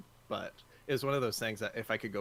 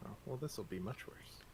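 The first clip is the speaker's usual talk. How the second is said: unvoiced whisper, just breath; 9 dB quieter than usual. Real, not acted. Whispering to his co-host mid-match, unvoiced whisper. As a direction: whisper it so the mic barely hears.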